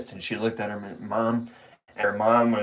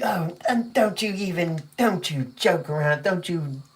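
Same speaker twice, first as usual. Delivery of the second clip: mom scolding; 5 semitones higher than usual. Retold or imitated. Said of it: "her mom was like".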